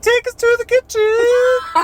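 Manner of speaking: loud, high, slow churchy wail; mock plea. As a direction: beg for food like a revival plea. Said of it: "We're like".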